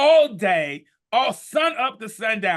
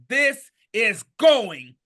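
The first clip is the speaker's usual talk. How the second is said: building excitement; 6 semitones higher, 5 dB louder, much slower than usual. Shown as a demonstration. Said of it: "demonstrating flipping the script".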